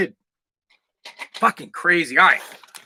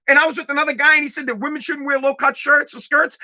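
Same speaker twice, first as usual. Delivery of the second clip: normal talking voice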